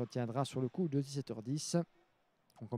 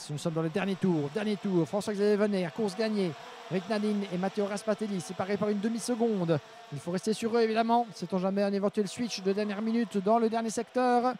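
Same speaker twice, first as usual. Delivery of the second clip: higher, louder, building last-lap call; motorsport commentator; 6 semitones higher, 5 dB louder than usual. Real, not acted. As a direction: push the energy up as the lap ends.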